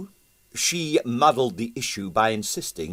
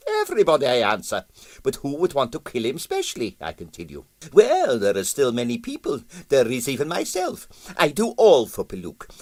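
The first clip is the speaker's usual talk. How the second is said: put-on higher, Russian-accented, musing aloud and pleased with himself; pompous Russian impresario; 5 semitones higher than usual. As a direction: talk it through like a vain theorist. Stroganoff voiced by narrator.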